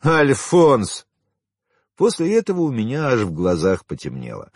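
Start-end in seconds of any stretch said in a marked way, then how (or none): 0.0-1.0 high, loud, contemptuous jab; mean, mocking name-calling in a raised voice; spiteful insult. A narrator's reading. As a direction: fling the word to wound. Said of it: "Она зло хохотнула и обидно бросила".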